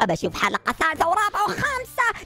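ordinary talk

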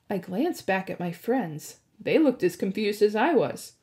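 ordinary delivery, nothing marked

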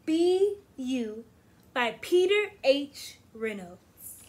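expressive picture-book read-aloud; reading to children. Acted. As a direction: read it warmly to a class. Teacher reads picture book aloud.

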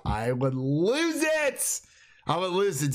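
high, drawn-out excited outburst; excited gushing. Retold or imitated. Fan imagining Booksmart winning, excited gushing. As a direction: stretch it out high in giddy excitement.

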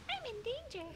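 very high little voice; squeaky echo. Pretend. Echoing the quote much higher.

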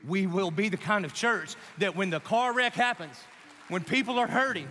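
high, forceful rallying sermon; full-force preacher building a charge; impassioned preaching. Real, not acted. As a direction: drive each phrase home to the congregation.

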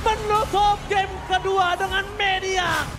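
hyped esports play-by-play; fighting game announcer. Acted. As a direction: call the game-winning fight. Hypes a teamfight live.

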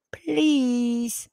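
put-on high begging little girl's please; pleading little girl. Granddaughter begging grandma, high pleading child voice. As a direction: beg like a small child with hands pressed together.

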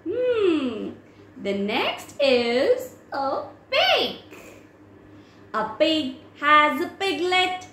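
ordinary delivery

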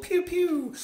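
high-pitched falling "pew, pew"; playful pew-pew sound effect. Pretend. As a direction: imitate little zaps in a high voice. Imitates spaceships flying off.

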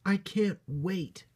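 high, drawn-out eager bunny voice; excited. A narrator's reading. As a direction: savour the excitement as a small bunny. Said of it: "said Bot excitedly".

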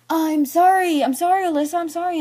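high singsong imitation of an apology; mock apology. Boy following the girl to apologize, mock apology. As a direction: mimic him apologizing, pitched well up.

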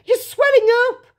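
high, loud cry of horror; scream. A narrator's reading. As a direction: scream like a panicking mother. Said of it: "screamed Missus Beauregard".

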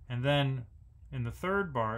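ordinary delivery

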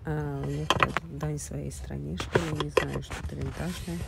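ordinary talk, nothing marked

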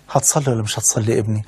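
soft fatherly coaxing; gentle fatherly question. Retold or imitated. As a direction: ask it tenderly, quietly, like a loving father. Prophet gently asks young man.

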